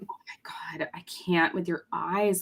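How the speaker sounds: breathy unvoiced whisper of awe. Real, not acted. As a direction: whisper it, stunned.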